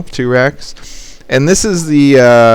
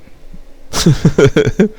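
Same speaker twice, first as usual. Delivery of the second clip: high giggle; 6 semitones higher than usual. Real, not acted. Laugh after joke.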